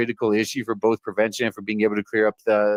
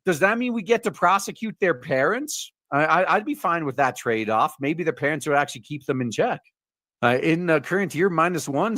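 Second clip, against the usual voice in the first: normal talking voice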